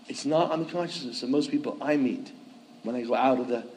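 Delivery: normal talking voice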